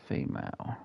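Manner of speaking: unvoiced whisper of the same word Female. Real, not acted. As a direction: breathe Female. out with no voice. Repeats it whispered.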